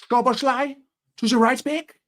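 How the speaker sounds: high-pitched imagined manager's question; Jurgen impression. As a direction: put on the manager's voice, pitched well up. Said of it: "how I would imagine Jurgen saying that".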